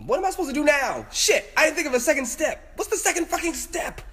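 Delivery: high, frantic squeal; high-pitched flustered panic; panicked realization. Real, not acted. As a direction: squeal it, totally at a loss. Trapped bug, no next step.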